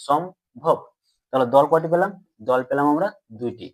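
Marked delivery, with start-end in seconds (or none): 0.1-0.8 সম্ভব as "shom, bhob"; two distinct beats in সম্ভব, shom then bhob; syllable by syllable. Shown as a demonstration. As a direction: demonstrate the break inside সম্ভব. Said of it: "এভাবে তো উচ্চারণ করছি".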